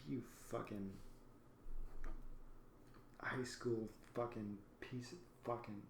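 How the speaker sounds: low, soft muttered swearing; seething quietly at the bad driver; muttered curses. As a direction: mutter the insults under your breath. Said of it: "I was so upset".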